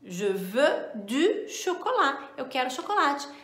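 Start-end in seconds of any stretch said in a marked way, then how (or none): 0.0-2.3 "Je veux du chocolat" said slowly; careful model sentence; slow clear "Je veux du chocolat"; slow French demo. Shown as a demonstration. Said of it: "eu uso".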